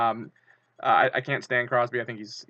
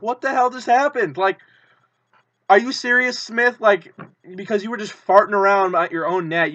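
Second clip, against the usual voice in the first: high, loud, furious disbelief; outraged yelling; 8 semitones higher, 8 dB louder than usual. Retold or imitated. Fan yelling at goalie Smith after the giveaway, outraged. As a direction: yell at the goalie for the blunder. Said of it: "we're like".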